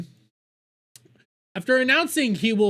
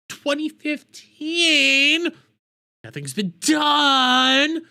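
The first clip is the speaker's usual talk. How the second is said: higher, louder, slowly stretched mock cry; mock wail; 7 semitones higher, 5 dB louder, much slower than usual. Ranting at the governor about years of fraud, mock wail. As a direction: wail it like it's hopeless, slowly.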